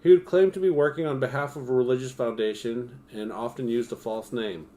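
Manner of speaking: projected, scripted storyteller delivery; true-crime narration. A narrator's reading. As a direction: read it out like a documentary script.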